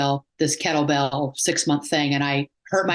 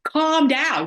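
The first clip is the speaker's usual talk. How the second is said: annoyed; 9 semitones higher than usual. Retold or imitated. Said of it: "she was getting annoyed with me".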